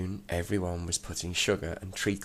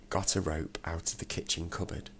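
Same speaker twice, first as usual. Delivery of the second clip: plain reading voice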